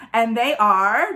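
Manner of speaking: plain reading voice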